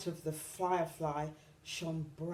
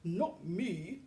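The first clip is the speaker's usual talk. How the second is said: heavy sighing hippo voice; lightly voiced hippo sigh. A narrator's reading. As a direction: sigh it out wearily. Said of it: "sighed the hippo".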